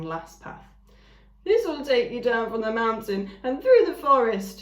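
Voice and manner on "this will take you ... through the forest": higher, louder friendly voice; smiling ram. A narrator's reading. Ram pointing the travellers down the path, smiling. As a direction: say it with a smile. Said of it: "he said, smiling".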